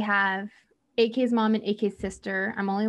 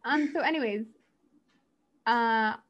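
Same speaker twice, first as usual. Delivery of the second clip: ordinary talk, nothing marked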